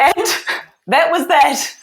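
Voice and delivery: words bubbling through real laughter; laughing while retelling the moment. Real, not acted. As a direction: laugh as you tell the punchline. Laughing at the memory.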